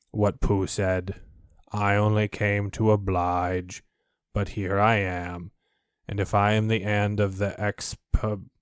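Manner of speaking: low, slow gloomy donkey grumble; gloomy Eeyore. Acted. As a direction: drag each word down in weary complaint.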